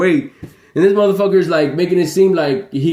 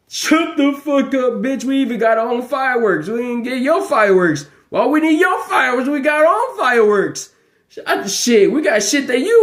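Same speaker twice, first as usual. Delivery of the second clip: higher, worked-up scolding; heated rant; 7 semitones higher than usual. Real, not acted. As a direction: fire it back at them. Ranting back at the critics.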